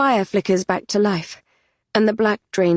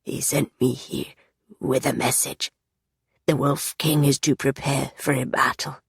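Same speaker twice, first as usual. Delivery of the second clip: haunted whisper; 4 semitones lower, 3 dB quieter than usual. Acted. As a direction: recall the horror quietly. Low grim voice.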